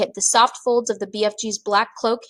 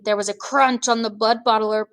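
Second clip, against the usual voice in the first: ordinary delivery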